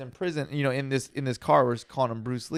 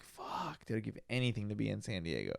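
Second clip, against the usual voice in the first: low, quiet aside of regret; hushed longing; 5 semitones lower, 12 dB quieter than usual. Retold or imitated. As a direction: say it softly, wishing you were home. Retelling his longing for home.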